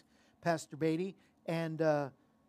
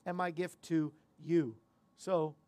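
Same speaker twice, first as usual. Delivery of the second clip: ordinary delivery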